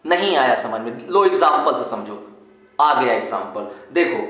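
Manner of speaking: usual speaking voice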